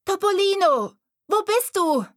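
high, loud worried call; girl calling. A narrator's reading. Girl on the path calls loudly for her kitten. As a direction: call out high for the missing cat.

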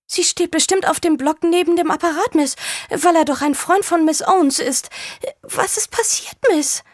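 a nosy, worried housekeeper; anxious housekeeper. Acted. Housekeeper voice held.

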